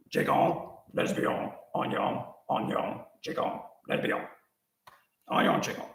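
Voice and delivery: nonsense words in a put-on Cajun accent; mock Cajun accent. Birthday call to family, answering in mock Cajun accent. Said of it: "this Cajun accent".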